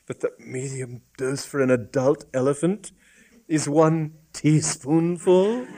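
measured, horrified statement, slowing at the end; stunned, deliberate delivery; low, toneless. A narrator's reading. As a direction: spell out the awful fact gravely. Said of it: "he said in a low, toneless voice".

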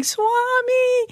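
high panicked cry of the name; panicked scream. Retold or imitated. Woman in back seat calls out to Swami, high panicked cry. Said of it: "this woman was screaming".

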